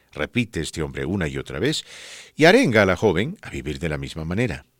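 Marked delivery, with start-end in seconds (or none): none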